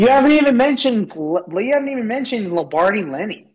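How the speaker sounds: very high-pitched disbelief; incredulous protest. Real, not acted. Co-host objecting to a skipped player, high incredulous protest. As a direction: squeal it in outraged disbelief. Teasing protest at co-host's omission.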